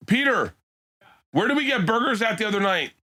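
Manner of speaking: high-pitched call across the room. Real, not acted. Calling out to Peter off mic.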